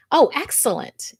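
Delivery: ordinary talk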